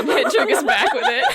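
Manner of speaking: high, loud laugh through the words. Real, not acted. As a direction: burst out laughing as you say it. Player laughing as her character strikes back.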